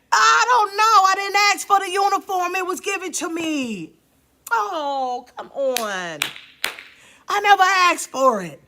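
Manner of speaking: impassioned preacher's cry; full-force preaching shout. Acted. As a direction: proclaim it to the whole room.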